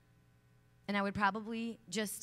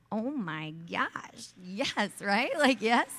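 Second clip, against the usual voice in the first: louder and louder, rising much higher; thrilled cheer; 4 semitones higher, 9 dB louder, much slower than usual. Retold or imitated. Speaker reliving her thrill at the football player's story, cheering. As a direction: burst out in delight.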